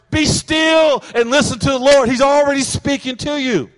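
loud, high preacher's shout; emphatic exhortation. Real, not acted. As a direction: cry it out to halt the restless seekers. Preacher cuts off acted restless seeker.

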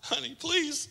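a hint of a soft mom pleading with dad; mock pleading mom. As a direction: coax like a doting mother. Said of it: "moms ... You're like".

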